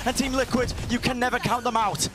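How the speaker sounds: high, loud hype play-by-play; esports caster. Acted. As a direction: call every move with rising hype. Live play-by-play climax.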